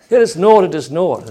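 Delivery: lightly acted dismissive buyer; haggling buyer. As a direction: start high and loud, then drop back. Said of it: "saith the buyer".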